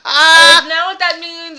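loud high laughing protest; can't stop laughing while denying. Real, not acted.

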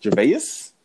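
ordinary talk

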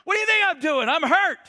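high, loud, half-laughing complaint of a hurt man; playing the wounded husband for laughs; mock indignant whine. A wounded husband whines at his wife, high and loud with a laugh.

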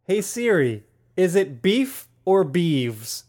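slow, careful question for Siri; slow clear question. Acted. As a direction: speak slowly to the phone. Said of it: "see if Siri gives me".

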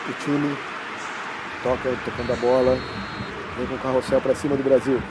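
radio match narration. Acted. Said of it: "eu narro esse gol pra vocês".

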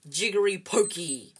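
loud, fierce incantation; fiercely intoned mock spell. A narrator's reading. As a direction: cast it like a threatening spell. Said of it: "said Harry in a fierce voice".

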